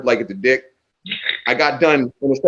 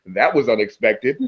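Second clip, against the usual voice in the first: lower, understated remark; deadpan; 4 semitones lower than usual. Retold or imitated. Battle rapper addressing the crowd, dry deadpan. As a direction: drop it dryly for the laugh.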